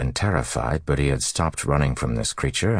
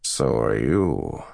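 scornful villain Moradin. Acted. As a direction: taunt an old enemy with lofty contempt.

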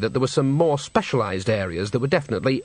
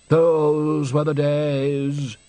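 very slow, wistful sigh of a line; sad; 3 semitones higher, much slower than usual. A narrator's reading. Old director mourning proper opera, slow and sad. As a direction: let the old days ache in it, slowly. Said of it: "said Undershaft sadly".

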